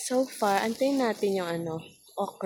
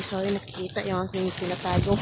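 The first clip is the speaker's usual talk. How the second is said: ordinary delivery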